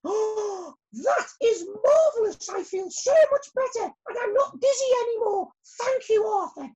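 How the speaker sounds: very high, loud joyful queen; hammed-up, overjoyed storybook voice; delighted Queen Bee. A narrator's reading. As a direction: gush with relief and thanks.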